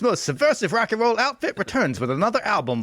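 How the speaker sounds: high-pitched, hammed-up alarmist announcer; over-the-top moral-panic warning; silly impression voice. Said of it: "that's the end of the impression era".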